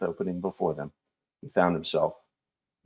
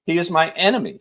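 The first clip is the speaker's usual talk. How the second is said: simply; 10 semitones higher, 5 dB louder than usual. A narrator's reading. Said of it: "said Evan simply".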